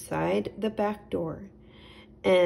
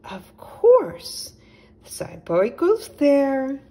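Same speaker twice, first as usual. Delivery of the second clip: high, loud bright-idea owl; exclaiming owl voice; sudden realisation; 7 semitones higher, 7 dB louder than usual. A narrator's reading. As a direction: owl suddenly struck by the answer. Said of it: "said suddenly".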